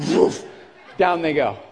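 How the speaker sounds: ordinary talk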